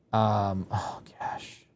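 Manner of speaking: despairing whispered aside; exasperated whisper. Real, not acted. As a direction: breathe it out under your breath in dismay.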